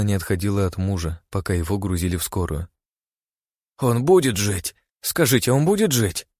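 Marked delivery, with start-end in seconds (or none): 3.8-6.3 desperate pleading, rising high. A narrator's reading. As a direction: beg for an answer. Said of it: "с мольбой вопрошала она".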